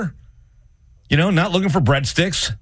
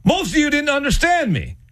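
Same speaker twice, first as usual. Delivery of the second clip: mock speech; 8 semitones higher than usual. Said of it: "and then go".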